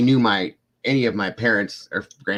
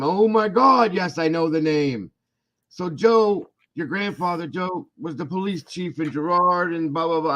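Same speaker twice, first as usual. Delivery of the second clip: put-on old coworker recalling the family, high and excited; excited old man; 10 semitones higher than usual. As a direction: gush like an old man who just placed you. Imitating the old man's excitement.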